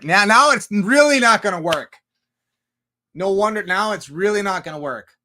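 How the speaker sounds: high, exasperated telling-off; hammed-up exasperation; mock scolding. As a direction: start loud and high, as if fed up. Scolds the imagined car curser.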